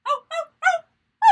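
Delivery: high, loud wolf howl. Shown as a demonstration. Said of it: "Let's try it. Me first".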